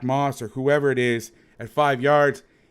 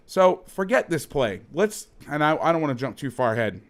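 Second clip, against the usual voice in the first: ordinary delivery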